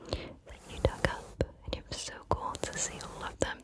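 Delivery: normal talking voice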